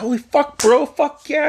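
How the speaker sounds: high-pitched reenacted hype; thrilled. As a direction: cheer on a teammate with giddy excitement. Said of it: "I was so excited".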